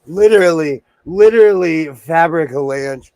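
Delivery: loud laughing dismay; loud exclamation. Real, not acted. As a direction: insist it really was an avalanche. Fabric falls down.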